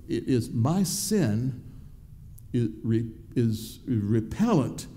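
normal talking voice

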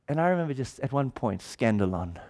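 normal talking voice

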